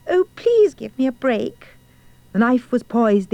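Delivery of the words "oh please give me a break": pleading cry. A narrator's reading. Frightened girl begging the huntsman, high pleading cry. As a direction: beg for mercy in a higher voice. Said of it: "She cried".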